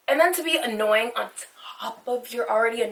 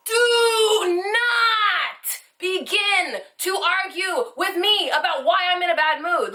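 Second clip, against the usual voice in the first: raging shout; 6 semitones higher, slower than usual. Real, not acted. Venting to viewers about being interrupted, raging shout. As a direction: shout it out like you're losing it.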